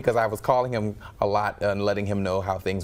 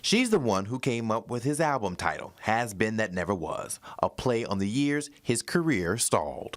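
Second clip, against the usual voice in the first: steady, polished newscaster delivery; news read; 3 dB quieter than usual. Acted. Anchor introducing a local musician's story, news read.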